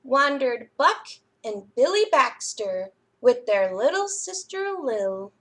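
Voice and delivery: lively, expressive storytime read-aloud; reading to children. A narrator's reading. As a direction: read it like a librarian to little kids. Reads picture book to children.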